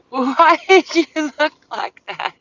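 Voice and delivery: high, slow, giggly question; laughing while asking. Real, not acted. Asking the dog about his face, laughing high. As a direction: ask it through laughter.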